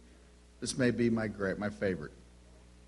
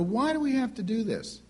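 put-on much higher whiny kid question; questioning child; 9 semitones higher than usual. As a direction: voice a child pushing back.

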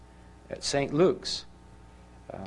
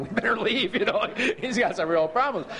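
higher voice, breaking up with laughter; laughing retelling; 9 semitones higher than usual. Retold or imitated. Men doubting their counselor can tell crying, said laughing. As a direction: laugh through the quoted words.

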